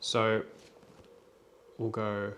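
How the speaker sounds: ordinary talk, nothing marked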